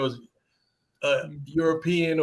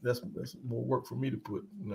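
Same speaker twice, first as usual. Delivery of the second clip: ordinary delivery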